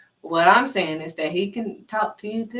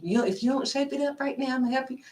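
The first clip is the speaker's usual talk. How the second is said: higher, put-on parental threat; parent warning kids; 5 semitones higher, 4 dB quieter, faster than usual. Parent telling kids to shape up, warning. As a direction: play a parent laying down the law.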